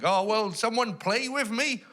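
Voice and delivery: put-on high-pitched pleading child voice; piping, rising toddler request; little toddler. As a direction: imitate a small child begging for a playmate. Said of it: "like a little toddler".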